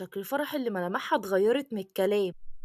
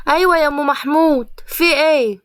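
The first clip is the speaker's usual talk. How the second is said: raised, loud neighbourly call; calling back; 4 semitones higher, 7 dB louder than usual. Acted. Mother answers the neighbour who called, calling out. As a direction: answer loudly, pitched up, as if across the flat.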